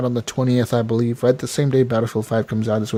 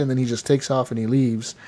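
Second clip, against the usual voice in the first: normal talking voice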